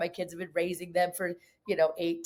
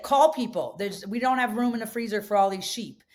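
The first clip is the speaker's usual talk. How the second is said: husband's blunt command; 4 semitones higher than usual. Retold or imitated. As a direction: snap her out of it, be gruff and urgent. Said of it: "and he's like".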